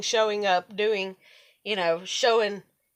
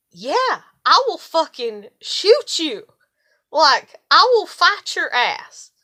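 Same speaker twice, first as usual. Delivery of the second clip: louder, slower, higher and menacing; angry threat; 5 semitones higher, 7 dB louder, slower than usual. Retold or imitated. Warning anyone who shows up uninvited, a loud slow threat. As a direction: lean into it like you mean every word. Threatening trespassers on her property.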